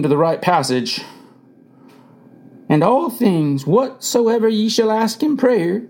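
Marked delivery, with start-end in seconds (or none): 2.7-5.8 slow, higher-pitched Bible reading; reading scripture aloud. A narrator's reading. Mother reading a Bible verse at the deathbed, slow recital. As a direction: read the verse out slowly and deliberately. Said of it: "he told Mother to read aloud".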